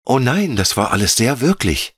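put-on high little girl's voice, pleading; a small child afraid to be left. A narrator's reading. Veronika begs the air spirit to stay, high child voice. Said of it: "sagte Veronika".

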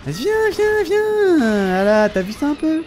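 squeaky high mocking taunt; sing-song high teasing of the monster. Real, not acted. Player taunting the boss in a high mocking squeal. Taunting enemy during a fight.

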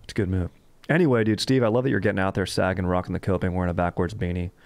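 ordinary delivery

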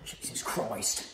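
slow, exasperated whisper; whispered curse. Acted. Chef despairing at his helper, slow whisper. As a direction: sigh it out under the breath. Exasperated aside under breath.

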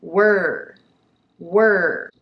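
were with teeth almost shut; long r-coloured er in were; nearly closed teeth. Shown as a demonstration. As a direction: say were through a narrow mouth, sounding the R. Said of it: "wereは歯をほとんど閉めたまま発音します".

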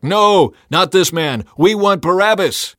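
loud, high shouted refusal; crowd shout. A narrator's reading. Crowd shouting back at Pilate, loud shout. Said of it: "they shouted back".

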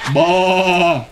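long drawn-out bleat; sheep baa. A narrator's reading. Sheep answering Duck, sustained baa.

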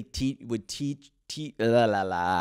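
tripping into la-la-la; stumbling over the tongue; tongue-tied. Real, not acted. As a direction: garble a word into la-la babble. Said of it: "I can't speak".

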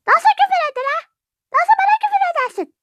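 fast, high-pitched protest; indignant outrage. Acted. Quarrelling over being sworn at, indignant outrage. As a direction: fire it off high and offended.

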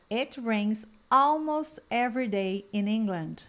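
slow, clear drill sentence; slow drill model. Shown as a demonstration. Teacher leading a repeat-after-me drill, slow. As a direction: model it slowly so they can repeat. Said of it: "Vamos repetir essas frases".

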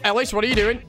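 usual speaking voice

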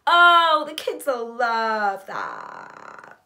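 high loud gush, slowly drawn out; gush, stretching the last words; feigned delight. Acted. Narrator responding to the trampoline news, exaggerated slow delight.

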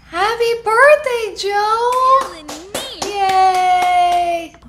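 high, loud birthday cheer; excited cheer. Real, not acted. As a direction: celebrate out loud with a big happy shout.

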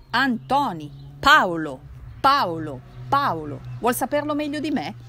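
put-on loud, hammering Piedmontese drunk; loud stubborn Turinese cousin; insistent drunk shout. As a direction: bang each name down, sure he is right. Cousin loudly insists on the name.

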